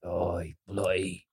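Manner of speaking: low, slow, quiet Cockney-style growl; low, muted put-on British accent; Peaky Blinders. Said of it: "watching too much Peaky Blinders".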